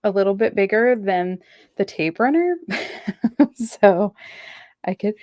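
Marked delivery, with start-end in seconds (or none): 2.6-3.5 sudden sneeze into the mic; real sneeze, caught off guard; sneezing. Real, not acted. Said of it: "I just sneezed right into the microphone".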